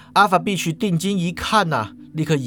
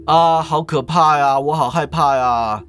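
hammed-up slow fake fright; fake fear; 3 semitones lower than usual. Fan Kuang pretends fright for Alpha Beach, hammed-up slow. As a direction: overplay being scared on cue. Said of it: "使个眼色".

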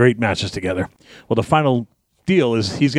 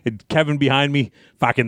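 ordinary talk

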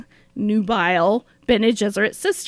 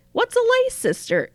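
naive question; 13 semitones higher than usual. Retold or imitated. Matilda asking innocently.

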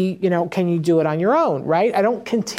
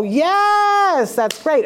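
big praise; 9 semitones higher, much slower than usual. Shown as a demonstration. Praising child's right answer.

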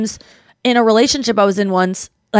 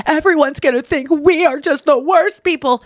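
higher, put-on worried mom voice; lightly mocked parental dismay; fretting mom; 5 semitones higher than usual. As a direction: imitate an embarrassed mother, pitched up. Voicing mom's worried reaction.

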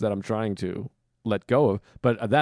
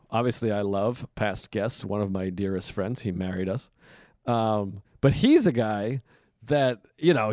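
usual speaking voice

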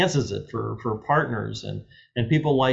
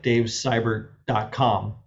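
ordinary talk

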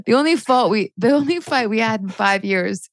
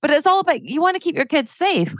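much higher, impassioned rant; 9 semitones higher than usual. Real, not acted. Ranting to the host about Disney mums, impassioned. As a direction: let the indignation run away with you. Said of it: "Sorry, I'm ranting".

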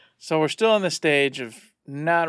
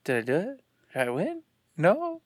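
expectant questioning; 3 semitones higher, 4 dB quieter than usual. Retold or imitated. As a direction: imitate an unsure player awaiting the verdict. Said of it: "looking expectingly at the D M and going".

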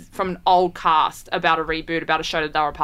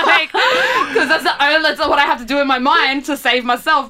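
worked up, talking higher and louder through laughter; laughing; 8 semitones higher, 5 dB louder than usual. Real, not acted. Podcaster admitting to violent daydreams, laughing. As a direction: laugh while insisting on it. Laughing over imagined physical altercations.

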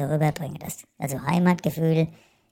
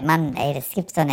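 normal talking voice

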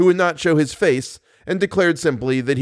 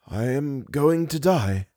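soft, slow, fading voice; weak dying man; 4 dB quieter, slower than usual. Acted. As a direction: let the words fade out. Eric repeats weakly.

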